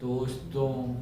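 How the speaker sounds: drawn-out syllables with long pauses; exaggeratedly slow lecture delivery; demonstrating very slow speech. Shown as a demonstration. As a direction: stretch each word out to show dragging speech. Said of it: "ऐसे बोलूँ".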